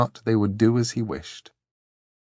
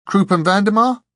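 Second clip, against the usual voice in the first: put-on young girl voice; 11 semitones higher, 8 dB louder than usual. A narrator's reading. Dor voiced.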